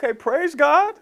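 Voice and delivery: high, tentative, rising ending; pondering praise. Acted. Listener starting to ponder the repeated word, questioning praise. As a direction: praise as if half asking. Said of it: "Start to kinda ponder".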